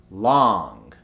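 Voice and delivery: long drawn out with open "ah"; American accent. Shown as a demonstration. Coach gives the converted word, American accent. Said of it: "to the American accent".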